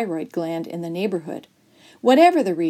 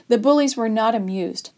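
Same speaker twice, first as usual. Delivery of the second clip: usual speaking voice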